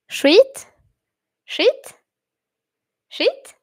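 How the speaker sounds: "skit" as "shweet", high questioning rise; Swedish pronunciation. Shown as a demonstration. Streamer testing for an N sound, Swedish pronunciation, questioning. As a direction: repeat "skit" high, checking its sounds by ear. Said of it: "I don't think I hear an N sound".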